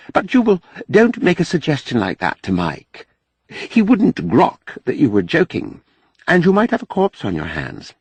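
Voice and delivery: lightly voiced Mahmud, earnest. A narrator's reading. Mahmud cautions Jubal not to joke with Mike, earnest.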